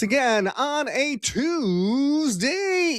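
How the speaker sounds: high, drawn-out announcer welcome; talk show host opening. Acted. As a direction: greet the crowd like a big TV host. Opens his show like a host.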